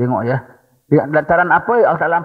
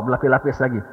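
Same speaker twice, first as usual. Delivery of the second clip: ordinary talk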